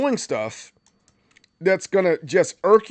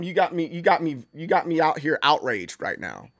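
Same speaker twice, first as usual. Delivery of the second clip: ordinary talk, nothing marked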